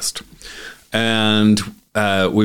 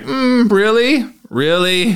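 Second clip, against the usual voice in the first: sceptical; 13 semitones higher, slower than usual. Retold or imitated. Doubting Tony Robbins.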